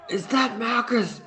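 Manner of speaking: fake scream. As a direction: shout it out to make everyone turn. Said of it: "scream again".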